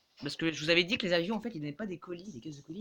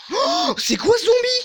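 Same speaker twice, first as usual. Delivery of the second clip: much higher, louder, slow stretched amazement; shocked cry; 10 dB louder, slower than usual. Real, not acted. Gamer spotting a strange zombie, shocked high cry. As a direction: gasp out at the weird monster.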